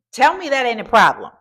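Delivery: ordinary delivery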